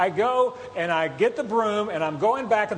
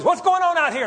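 high, louder wife calling from the door; 9 semitones higher, 5 dB louder than usual. Retold or imitated. Wife opens the garage door, calling out in a higher voice. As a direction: mimic the wife calling out, surprised. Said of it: "my wife opens the door and says".

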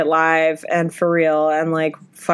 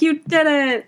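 cheering quote; 8 semitones higher than usual. Retold or imitated. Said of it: "And I was like".

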